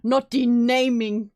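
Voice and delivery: gasp, then a slow, loud disbelieving correction; a real gasp of outrage at the word; shocked gasp. Real, not acted. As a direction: reel back at the word.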